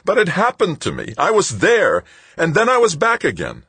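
distraught patient insisting to the nun; wailing. A narrator's reading. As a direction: wail it in despair. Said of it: "he wailed".

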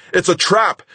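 high, loud warning cry; scream. A narrator's reading. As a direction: shout the alarm at the top of your lungs. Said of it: "screaming, ... at the top of my lungs".

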